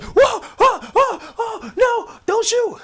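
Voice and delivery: very high falsetto yelps, hammed up; mock panic. Commander begging his soldiers not to shoot, comic high-pitched panic. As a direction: plead in comic squealing terror.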